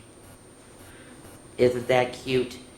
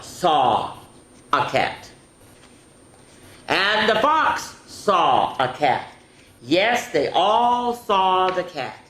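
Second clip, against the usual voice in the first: slow, higher storytime voice, animal names swooping up; picture-book read-aloud; 6 semitones higher, 6 dB louder, slower than usual. A narrator's reading. Reading They All Saw a Cat to children, dramatic storytime voice. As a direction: stretch each key word with suspense for small listeners.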